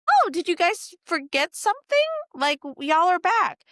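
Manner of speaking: high, bright mock-friendly greeting; sugar-coated surprise at returning guests; fake-sweet server. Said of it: "And I was like".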